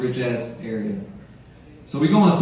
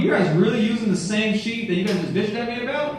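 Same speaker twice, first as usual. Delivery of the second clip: high-pitched incredulous complaint; mock indignation; 8 semitones higher than usual. Husband catching wife and father-in-law playing, acted indignant. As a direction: call out the double standard, pitch climbing.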